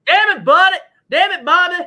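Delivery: high, loud Texan twang; exasperated Hank Hill yelp. Retold or imitated. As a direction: do Hank Hill scolding Bobby. King of the Hill quote.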